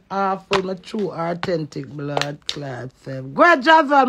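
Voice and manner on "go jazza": loud, high call out to Jazza; hollering a viewer's name; shouting a name. Real, not acted. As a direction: call him out loud across the room.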